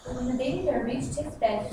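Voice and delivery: usual speaking voice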